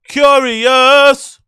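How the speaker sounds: high, loud shout of curious. Shown as a demonstration.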